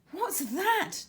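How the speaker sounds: high, startled "What's that?"; gasped question. A narrator's reading. Girl in the sea sees a grey triangle, a startled gasp. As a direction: catch your breath in alarm. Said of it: "Tara gasped".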